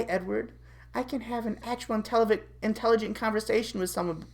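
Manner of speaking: usual speaking voice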